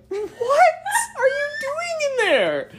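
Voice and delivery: high, slow, laughing disbelief; laughing question. Retold or imitated. Friends finding Julia hiding in a garage, laughing high. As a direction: ask it while cracking up.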